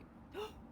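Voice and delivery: high unvoiced gasp; quick surprised intake of breath; breathy gasp. A narrator's reading. Storyteller gasps before judges.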